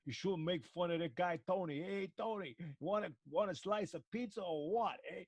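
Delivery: put-on loud, high Brooklyn tough-guy accent. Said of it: "you shouldn't do a Brooklyn accent".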